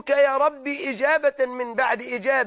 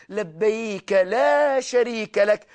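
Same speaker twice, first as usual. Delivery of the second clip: slow deliberate; 2 semitones lower, slower than usual. Shown as a demonstration. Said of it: "اسمع، اسمع".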